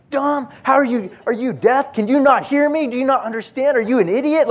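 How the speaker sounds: high, loud imitation of a furious lady; scream-scold in rapid-fire questions; imitating an irate woman yelling; mad yelling. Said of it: "screaming and yelling 'cause she was so mad".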